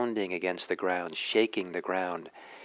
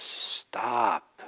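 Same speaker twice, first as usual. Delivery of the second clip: a restrained, drawn-out storyteller's roar; mock lion's roar; 3 semitones higher than usual. Lion halting the charging animals, mild mock roar. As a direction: lightly imitate a lion roaring a command. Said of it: "and roars".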